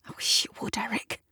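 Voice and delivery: soft unvoiced whisper; hushed plea. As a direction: whisper it gently. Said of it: "Gavin whispers".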